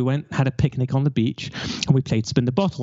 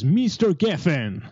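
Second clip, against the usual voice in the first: put-on Russian accent; 5 semitones higher, slower than usual. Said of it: "in that Russian accent that I can't do".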